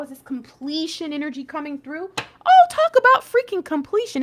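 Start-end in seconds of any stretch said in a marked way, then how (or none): 2.2-4.2 high, loud burst of amazement; excited outburst. Real, not acted. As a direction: exclaim it, thrilled at the card. Excited reaction to tarot card.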